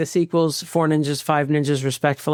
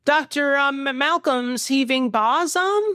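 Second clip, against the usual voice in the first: bad child actor; 8 semitones higher than usual. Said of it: "Just a bad child actor".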